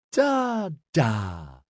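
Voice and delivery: put-on high baby talk, falling away; toddler voice. A narrator's reading. Toddler tottering in to greet daddy, high baby voice. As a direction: babble it high like a tiny child.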